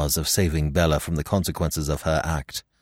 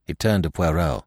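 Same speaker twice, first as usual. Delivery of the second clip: ordinary delivery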